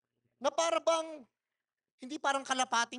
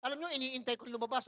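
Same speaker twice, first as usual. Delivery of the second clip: ordinary talk, nothing marked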